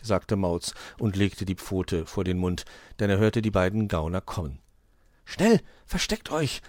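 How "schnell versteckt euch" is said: high, hurried warning, lightly hushed; anxious whisper. A narrator's reading. Hoppel warns friends the crooks are coming, anxious. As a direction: urge them to hide. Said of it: "flüsterte Hoppel ängstlich".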